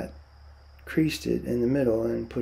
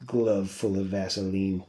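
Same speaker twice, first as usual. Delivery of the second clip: disgusted; 5 semitones lower, 4 dB quieter than usual. A narrator's reading. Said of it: "George said disgustedly".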